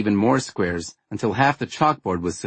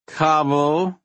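put-on drunk, slurring the word; slurred; 7 semitones higher, 3 dB louder than usual. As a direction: slur it like you're tipsy. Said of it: "I slurred".

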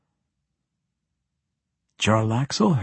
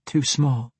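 uncertain recollection; 5 semitones higher than usual. A narrator's reading. Woman haltingly recalls the city.

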